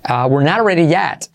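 usual speaking voice

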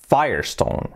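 Firestone as "faier-ston"; English pronunciation. Shown as a demonstration. Teacher giving learners the correct Firestone. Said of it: "la pronunciación es".

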